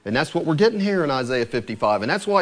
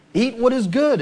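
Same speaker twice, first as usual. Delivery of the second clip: louder, higher urging plea; 8 semitones higher, 5 dB louder than usual. Retold or imitated. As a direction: press them to eat well. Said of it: "It's like he's pleading".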